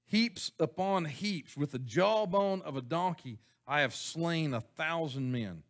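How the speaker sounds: slow, solemn scripture reading; slow solemn recitation. Acted. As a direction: read Samson's boast slowly and gravely. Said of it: "then he said".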